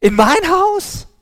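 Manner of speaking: high, loud startled cry; shouting in disbelief. A narrator's reading. Woman hearing God will visit cries out in shock. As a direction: shout it out, stunned that God would come. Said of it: "schrie sie".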